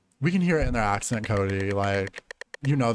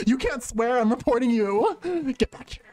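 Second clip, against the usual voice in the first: high-pitched mock threat; playful high-voiced telling-off; playful scolding; 9 semitones higher than usual. Laughing while threatening a fleeing player.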